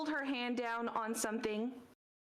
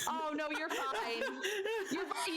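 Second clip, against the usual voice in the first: laughing; 8 semitones higher than usual. Real, not acted. Laughing reassurance to player.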